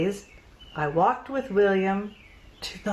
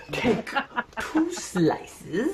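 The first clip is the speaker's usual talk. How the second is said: put-on German accent; 4 semitones higher, much slower than usual. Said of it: "fake German accent".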